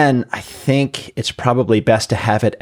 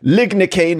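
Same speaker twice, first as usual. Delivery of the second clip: mock British medical dictation; British accent; 6 semitones higher, slower than usual. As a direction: pronounce it with put-on British grandeur. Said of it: "in British accent".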